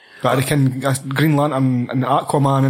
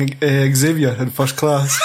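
ordinary delivery

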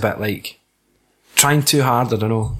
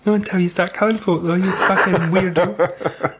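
a hint of a sugary teacher; pally-pally teacher; 6 semitones higher than usual. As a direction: sweet-talk her like a fake-friendly teacher. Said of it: "super pally-pally".

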